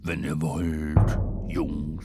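deep slow beast. A narrator's reading. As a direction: offer the ride like a gentle giant. Rhinoceros voice held. (tiefe, brummende Tierstimme)

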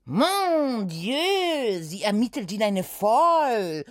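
leicht gespielter Aufschrei mit französischem Akzent; hoch und empört, französischer Akzent, nur angedeutet geschrien; Schrei. Acted. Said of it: "schrie die Frau". (Putzfrau staunt über Oda, gespielter Aufschrei mit französischem Akzent)

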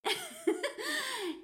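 high amused laugh. Acted. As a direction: laugh at his naivety. Laughs at K.'s question. (helles Lachen)

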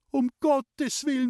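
very high, slow alarmed cry; panicked scream. A narrator's reading. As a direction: scream it out as the frightened mother. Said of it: "hat meine Mutter geschrien". (entsetzt aufgeschrien, hoch und gedehnt)

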